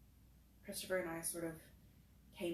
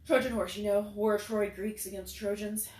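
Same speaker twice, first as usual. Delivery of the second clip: much louder, clear explanation; announcing to the group; 3 semitones higher, 8 dB louder than usual. A narrator's reading. Jalil explaining the Trojan horse to the group, loud. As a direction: say it out for everyone to hear. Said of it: "for the benefit of the rest of us".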